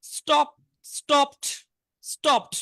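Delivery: slow drill, স্টপড as "stopt"; teacher models স্টপড with t for d; final t sound. Shown as a demonstration. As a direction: end স্টপড on a released t. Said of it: "টি উচ্চারণ হবে".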